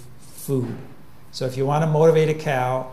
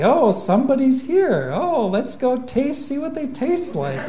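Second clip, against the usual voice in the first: high, eager cow voice; excited to meet someone; curious cow; 10 semitones higher than usual. Retold or imitated.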